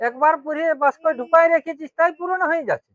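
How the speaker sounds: high-pitched protest; exasperated objection. Acted. Objects to demand for new clothes. (চড়া সুরে আপত্তি)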